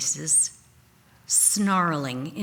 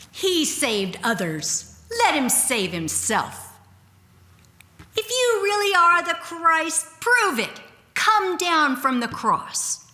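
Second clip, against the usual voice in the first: jeering crowd; 10 semitones higher than usual. Acted. Crowd jeering at Jesus on the cross, mocking. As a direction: sneer it up at the man on the cross.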